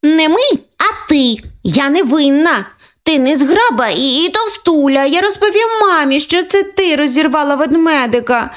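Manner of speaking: put-on high childish accusation; blaming little girl. Older sister threatening to tell mum, high childish voice. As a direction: push the blame, tattletale.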